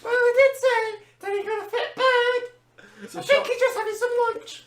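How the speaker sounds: put-on bright, squeaky young waitress delivering good news. Waitress cheerily announcing to rowdy family, high and loud.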